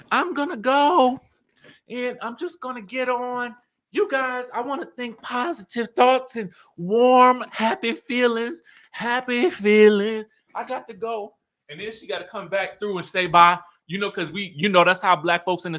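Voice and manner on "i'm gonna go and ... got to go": slow, high, drawn-out hoarse farewell; mimicked hoarse voice. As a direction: impersonate a hoarse woman making her exit. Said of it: "she hoarse".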